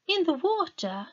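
put-on high girl's voice, alarmed at the idea. Meta balks at Sol's order to bathe, high and alarmed. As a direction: ask it as if the water could kill. Girl fears the river.

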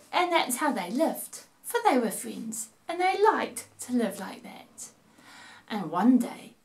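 put-on lively sing-song reading to children; storytelling voice. A narrator's reading. Narrator reading a rhyme to kids at home, playful storytelling voice. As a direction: read it like a bedtime rhyme for little ones.

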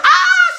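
high, loud imitated scream; mother's scream. Speaker retells mother's shock at the car, imitated high scream. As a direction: burst into a shrieking "Ah!". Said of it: "She was screaming and crying".

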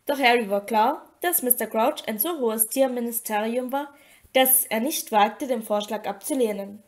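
ordinary delivery, nothing marked

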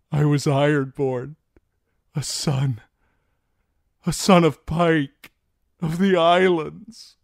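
high, loud anguished declaration; halting cry, climbing in pitch; tearful pleading. A narrator's reading. Theon pleads with the old gods, anguished cry. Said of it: "Tears trickled down his cheeks".

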